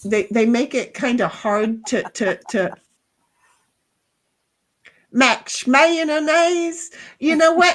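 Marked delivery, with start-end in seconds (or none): 5.1-6.9 slow, stretched Southern drawl on Max Mayonnaise; slurred Southern drawl, Mayonnaise stretched. Shown as a demonstration. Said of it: "it's got a Southern drawl to it".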